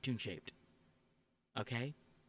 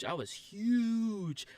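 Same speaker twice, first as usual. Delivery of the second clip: mock-dramatic admission; 8 semitones higher, 5 dB louder, much slower than usual. Real, not acted. Admitting toxic fandom past.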